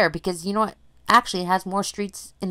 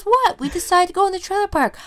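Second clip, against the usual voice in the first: much higher, fast mock teen voice; teen daughter; 14 semitones higher than usual. Daughter answering her worried mom, higher mock voice. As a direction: play the breezy daughter.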